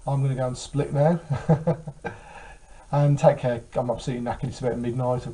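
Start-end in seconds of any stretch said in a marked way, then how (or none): 1.2-2.9 breathy, unvoiced laugh; real little laugh; sign-off chuckle. Real, not acted. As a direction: chuckle lightly as you wrap up. Laughs while signing off.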